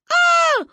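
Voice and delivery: loud, high-pitched scream; children's scream. A narrator's reading. Children on the pier, screaming. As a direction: shriek like kids watching from above. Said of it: "орали мы сверху".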